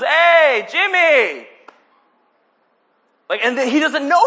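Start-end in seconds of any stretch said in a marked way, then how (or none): 0.0-1.5 high, loud shout over a parade crowd; big drawn-out acted hail; player calling out. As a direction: holler a name to someone in the crowd. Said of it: "One of the players goes".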